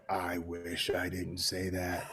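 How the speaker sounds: very slow, low, quiet aside; hushed regret. Retold or imitated. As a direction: let it sink in, almost under your breath. Instant regret after saying the line.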